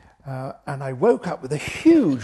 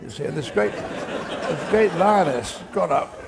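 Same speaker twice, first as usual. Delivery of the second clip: normal talking voice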